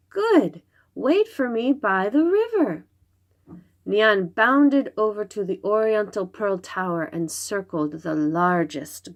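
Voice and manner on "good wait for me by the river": put-on high, eager child's voice; little girl voice. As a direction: chirp it like a pleased little girl. Little girl Xingling answers.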